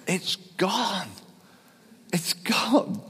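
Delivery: high amazed cry of relief; lightly imitated scream of joy; mock joyful scream. Woman healed of arthritis crying out to the preacher, high amazed cry. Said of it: "she screamed because she said".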